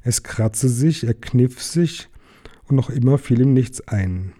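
usual speaking voice

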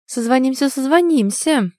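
high, excited sign-off; eager. A narrator's reading. Friend on speakerphone signing off, eager. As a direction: repeat it with gleeful zest. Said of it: "с азартом отозвался динамик".

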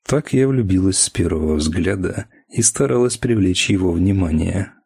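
plain reading voice

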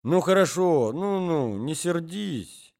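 put-on drawn-out sing-song old man. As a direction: soothe her in a sing-song. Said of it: "возразил старичок нараспев".

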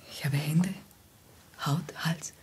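breathy close whisper, almost no voice; intimate whisper. Acted. Woman tempting her guest in a close whisper. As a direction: whisper it right into his ear.

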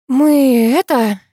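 very slow, stretched-out stalling; hesitant, drawn-out fumbling for an excuse; drawling. A narrator's reading. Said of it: "протянула я".

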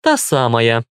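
unimpressed, dismissive confirmation; scornful snort. A narrator's reading. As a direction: confirm it with a mild huff. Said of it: "фыркнула Вика".

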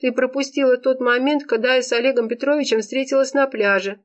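high, plaintive whine; tearful. A narrator's reading. Serafima fills in the missed moment, tearful. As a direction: explain in a high voice, still tearful. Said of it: "всё ещё содрогаясь от всхлипов".

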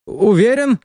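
high-pitched voice of a hidden companion; Ryazana's voice. A narrator's reading. Companion answering the call to come out, high-pitched doubtful voice. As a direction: pitch it way up, questioning.

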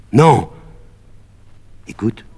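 sudden high loud cry; shouted no. Acted. Médée denying Jason left her, sharp loud cry. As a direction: fling out the no.

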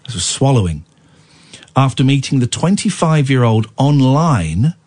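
normal talking voice

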